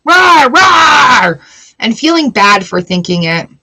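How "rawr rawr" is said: loud playful roar of rage; hammed-up rawr; mock growl. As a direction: growl like an angry beast.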